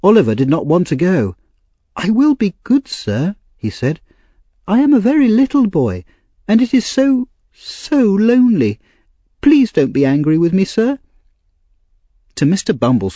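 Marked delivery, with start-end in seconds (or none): 1.9-3.4 put-on high-pitched small boy's voice; pleading little orphan. A narrator's reading. 4.6-11.0 put-on very high, lonely little-boy voice; pleading little orphan. A narrator's reading.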